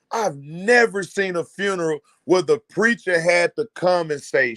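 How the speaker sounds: ordinary talk, nothing marked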